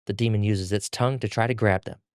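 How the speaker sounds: plain reading voice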